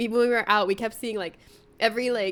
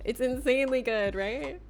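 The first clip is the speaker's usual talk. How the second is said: ordinary talk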